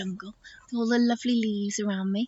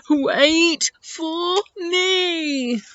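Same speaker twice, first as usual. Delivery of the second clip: very slow, higher elephant wail; calling elephant; 6 semitones higher, much slower than usual. Acted. Elephant left behind calling to friends, slow drawn-out call. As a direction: stretch each word as a lumbering call. Eddie calls after the others.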